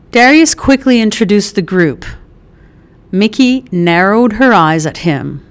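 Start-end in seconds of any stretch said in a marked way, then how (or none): none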